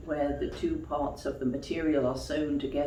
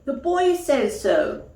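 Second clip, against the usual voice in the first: toy rabbit insisting; 10 semitones higher, 9 dB louder, slower than usual. Acted. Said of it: "cried the toy rabbit".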